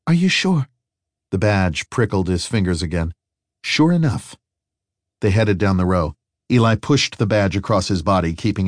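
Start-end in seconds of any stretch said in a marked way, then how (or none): 0.1-0.7 put-on young woman voice. Harry voiced by narrator. 3.6-4.4 put-on young woman voice. Harry voiced by narrator.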